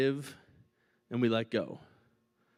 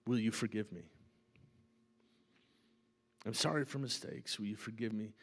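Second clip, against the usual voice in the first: softer, earnest confession; sincere repentance; 5 semitones lower, 8 dB quieter than usual. Shown as a demonstration. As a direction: quietly ask forgiveness like you mean it. Model repentant apology.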